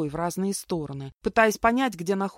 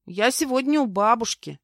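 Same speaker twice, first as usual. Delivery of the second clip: put-on higher, louder child's voice; little boy; 5 semitones higher, 5 dB louder than usual. A narrator's reading. Boy explaining himself to the librarian, childlike. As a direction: answer like an earnest small boy. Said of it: "пояснил мне мальчик".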